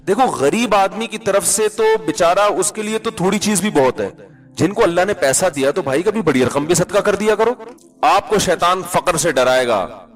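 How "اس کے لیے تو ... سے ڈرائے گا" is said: ordinary talk, nothing marked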